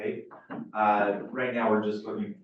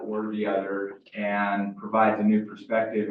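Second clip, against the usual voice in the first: normal talking voice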